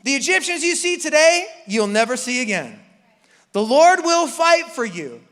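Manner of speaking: loud high proclamation; Moses shouting. Acted. Moses to the crowd, a loud high shout. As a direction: announce it with big bravado.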